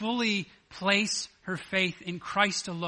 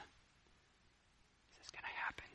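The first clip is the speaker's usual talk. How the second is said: hushed breathless whisper; suspenseful whisper; 20 dB quieter, slower than usual. Acted. Waiting crowd wondering at the tomb, hushed whisper.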